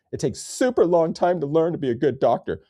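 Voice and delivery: high, prissy put-on voice; mocking voice. Mocking how the article talks, high put-on voice. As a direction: parody the article's smug tone. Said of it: "Who talks like that?".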